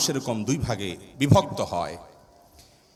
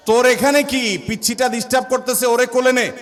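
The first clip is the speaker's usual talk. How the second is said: loud, higher scolding; scolding grandmother; 6 semitones higher, 4 dB louder than usual. Retold or imitated. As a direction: chase the child off sharply. দাদি এক ধমক দেয়. (দাদির ধমক, জোরে ও উঁচু স্বরে)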